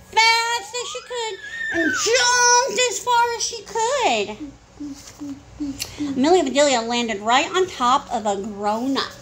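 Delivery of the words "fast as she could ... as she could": high, stretched-out storytelling; dramatic high-pitched narration for a child; excited narration. A narrator's reading. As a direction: build the big leap, voice held high. Dramatizing a running leap in story.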